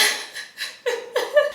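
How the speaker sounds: high airless giggle fit; wheezing laugh you can't stop; real helpless giggle, barely voiced; breathless silent laugh. Real, not acted. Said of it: "can't stop laughing".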